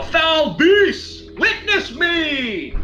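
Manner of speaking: high, drawn-out heroic challenge; in-character yell. Acted. Warrior calling out the foul beast, grand drawn-out yell. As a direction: bellow a grand challenge to a monster. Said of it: "And I yell".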